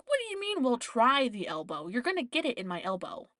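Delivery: affronted, insistent inner comeback; indignant. Retold or imitated. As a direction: throw the question back in mock outrage. Indignant inner reply to nurse's "try.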